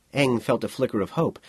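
usual speaking voice

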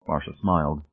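usual speaking voice

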